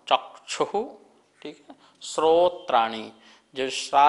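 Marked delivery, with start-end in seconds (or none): 0.1-0.8 चक्षुः with a light क before the श sound; ka sound in chakshu. Shown as a demonstration. Teacher correcting chakshu pronunciation for students. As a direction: say चक्षुः as chak-shu, the क clearly heard. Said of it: "अपन चा के बाद थोड़ा सा का का साउंड".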